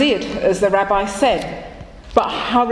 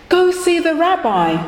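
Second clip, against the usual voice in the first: a wife urging her husband, pitched up; lightly voiced wife pressing him to go; wife telling her husband to see the rabbi, urging; wife's urging; 9 semitones higher than usual. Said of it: "she told him".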